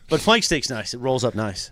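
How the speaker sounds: ordinary delivery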